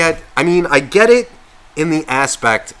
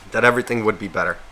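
normal talking voice

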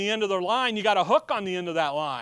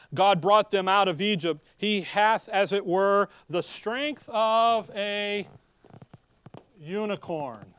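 solemn scripture reading; slower than usual. Acted. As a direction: proclaim the verses with weight. Reads King James scripture aloud.

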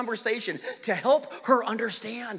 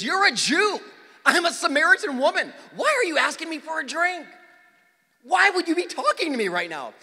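a lightly voiced woman, pitched up and louder; Samaritan woman, indignant; 8 semitones higher, 4 dB louder than usual. To Jesus at the well, lightly voiced indignant woman. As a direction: push back at him in her mock-indignant voice.

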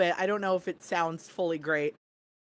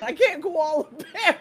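pitched up, laughing through words; 6 semitones higher, 3 dB louder, slower than usual. Real, not acted. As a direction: laugh the pun out. Laughing repeat of the pun.

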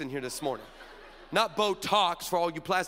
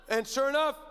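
usual speaking voice

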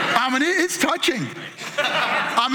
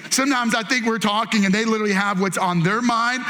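ordinary talk, nothing marked

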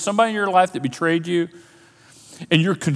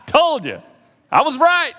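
much higher, louder, laughing smugly; gloating told-you-so; 11 semitones higher, 4 dB louder, slower than usual. Pretend. Imagined Joseph gloating over his bowing brothers, smug. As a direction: rub it in with a cackle. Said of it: "we would have been like".

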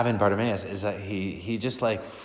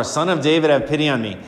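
raised, desperate plea; crying out louder; 6 semitones higher, 7 dB louder, faster than usual. Acted. Beggar calling over the crowd, louder plea. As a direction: cry out louder than the crowd. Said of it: "he cries out all the more".

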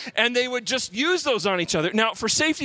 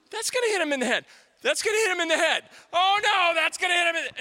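higher and higher, faster panic; rising panic; 10 semitones higher, faster than usual. Retold or imitated. Boy watching dart fall toward friend, rising panic. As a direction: panic more each time it's said.

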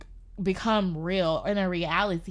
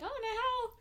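scared; 12 semitones higher, 3 dB quieter than usual. Retold or imitated. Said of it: "like, I was like".

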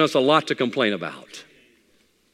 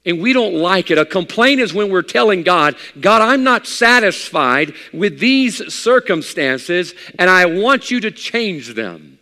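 loud, raised-pitch pulpit delivery; forceful preaching; 7 semitones higher, 7 dB louder, slower than usual. Acted. Preacher voicing a complaint to God, forceful preaching. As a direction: preach it out with drawn-out key words.

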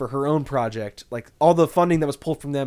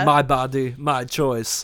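action movie one-liner; slower than usual. Retold or imitated. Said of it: "like a action movie one-liner".

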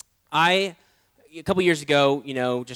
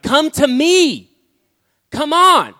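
high, loud and very slow call; calling voice of God; 11 semitones higher, 12 dB louder, much slower than usual. Retold or imitated. God calling him in the car, loud and insistent. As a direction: summon him home with force. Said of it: "the Lord was like".

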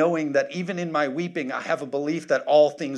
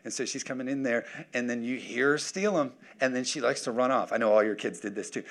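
usual speaking voice

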